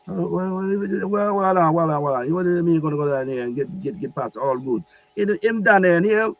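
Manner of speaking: high, fussing Jamaican disbelief; Jamaican accent. Retold or imitated. Archangel questions Gabriel's errand, high Jamaican accent. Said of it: "he had, like, this Jamaican accent".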